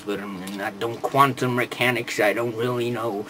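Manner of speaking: low, soft mock robot medic; Pipes. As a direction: mumble it as a hapless medic. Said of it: "he goes".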